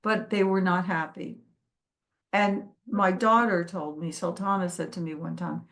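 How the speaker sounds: usual speaking voice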